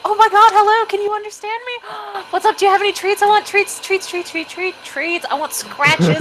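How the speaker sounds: very high, gushing excited puppy voice; squealing overjoyed dog. Acted. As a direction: yap it out like a dog begging for treats.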